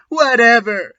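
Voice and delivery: hammed-up teen shout; mock angry shout. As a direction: snap back like a sulking teen. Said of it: "I shouted".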